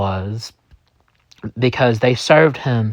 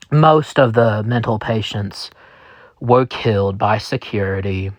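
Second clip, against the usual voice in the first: ordinary delivery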